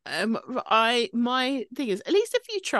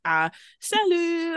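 mock French accent; 10 semitones higher, slower than usual. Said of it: "being like".

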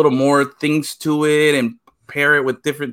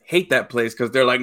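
ordinary talk

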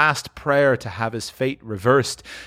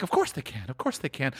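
to himself; 8 dB quieter than usual. Acted. Scrooge talking to himself, softer murmur. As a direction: drop the volume, reassuring yourself. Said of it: "said Scrooge to himself".

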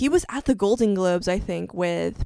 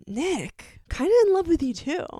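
swooning fan crush; 9 semitones higher, 3 dB louder than usual. Pretend. Fan confessing her crush on [name], high gushing squeal. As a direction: gush it out like a smitten teenager. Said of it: "I'm like".

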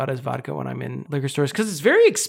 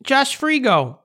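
hammed-up Chicago accent, pitched up; 7 semitones higher, slower than usual. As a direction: announce it as a mock Chicagoan. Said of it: "we haven't done a single Chicago accent".

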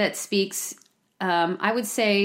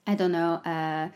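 slightly lower, French-tinged mumble; French accent; 4 semitones lower, 5 dB quieter than usual. Shown as a demonstration. Showing what gets away with a French accent, light French accent. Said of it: "I don't know," with a French accent".

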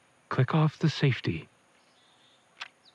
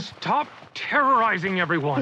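raised, forceful order; angry command; 6 semitones higher than usual. Acted. Father restraining Dean, angry command. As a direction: stop him hard.

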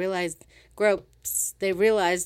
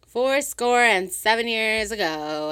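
mock speech; 4 semitones higher, 7 dB louder, slower than usual. Drunken mock oration.

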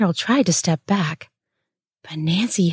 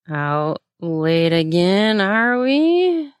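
slurred; 2 semitones higher, much slower than usual. A narrator's reading. Mentor taunting her apprentice, slurred and loose. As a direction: drag it out, loose-tongued and mocking. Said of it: "Nancy slurred".

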